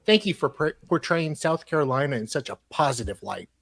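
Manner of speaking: reading a letter out in a measured, formal tone; email read aloud. Acted.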